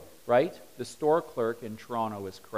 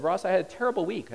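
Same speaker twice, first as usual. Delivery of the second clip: quick, higher self-pitying whine; pampered CEO; 7 semitones higher, faster than usual. Retold or imitated. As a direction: play the spoiled executive moaning. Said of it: "he said".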